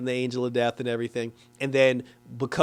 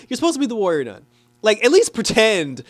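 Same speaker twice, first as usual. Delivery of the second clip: exasperated scolding; 7 semitones higher, 5 dB louder than usual. Retold or imitated. Scolding the crying warrior.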